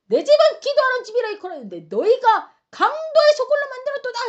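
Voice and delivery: high, loud, indignant denunciation; furious rebuke. Acted. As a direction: thunder it out in outrage. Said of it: "화가 머리 꼭대기까지 나신 거예요".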